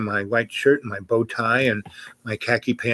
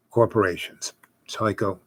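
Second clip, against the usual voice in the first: normal talking voice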